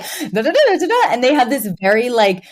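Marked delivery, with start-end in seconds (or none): 0.0-1.1 mock British banter. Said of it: "very specific banter".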